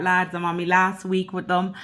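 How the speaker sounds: ordinary talk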